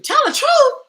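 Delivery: very high, loud squeal of disbelief; mock shocked echo. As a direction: shriek it back, playing scandalized. Shocked echo of friend's calm advice.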